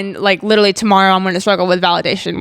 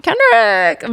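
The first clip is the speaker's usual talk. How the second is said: fangirl swoon; 7 semitones higher than usual. Real, not acted. Fangirling over [name].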